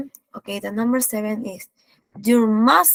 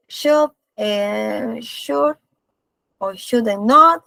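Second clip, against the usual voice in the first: slow, spelled out; much slower than usual. Shown as a demonstration. Teacher says the options slowly.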